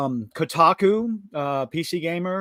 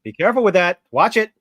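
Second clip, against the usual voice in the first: loud, higher teasing warning; mock warning; 6 semitones higher, 8 dB louder, faster than usual. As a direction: warn him, playfully loud. Warning friend over a word.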